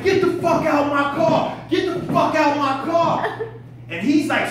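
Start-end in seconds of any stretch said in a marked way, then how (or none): 0.0-3.4 loud, high, angry yelling; furious shout. Retold or imitated. Fighting the intruder off, yelling to get out of the car. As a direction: scream him out of the car. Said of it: "I'm like".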